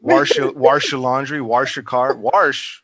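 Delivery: wash hammed up as "warsh", with an r; emphatic mock-local "warsh" for every wash; local pronunciation. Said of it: "didn't know what they were saying".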